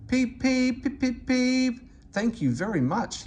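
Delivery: very high, whistled peeps, slowing; happy whistle. A narrator's reading. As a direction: toot like a proud engine. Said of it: "he whistled happily".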